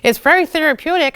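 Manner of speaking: high, sing-song mock lady voice; hammed-up chirpy reassurance; imitating a sugary volunteer; sweet volunteer lady. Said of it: "And she's like".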